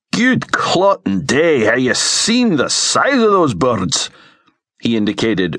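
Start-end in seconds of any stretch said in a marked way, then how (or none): 0.1-4.1 high, slow Scots exclamation of dismay; aghast. A narrator's reading. Alex is told to sneak to the cages, aghast. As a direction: draw it out in appalled Scots. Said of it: "Alex was aghast".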